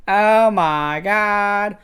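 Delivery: high, very slow drawn-out wail; movie quote imitation. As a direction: stretch every word in hammed-up horror. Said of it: "saying it just like him".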